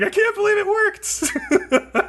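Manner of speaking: squeaky high, slow wail; hammed-up tearful disbelief, dragging the words; mock bawling. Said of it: "He starts bawling".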